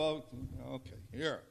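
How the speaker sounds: soft, low, sheepish giving-in; meek compliance. Acted. Imagined Peter yielding to the foot washing, soft and meek. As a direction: give in quietly, low and small. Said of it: "Pete would go".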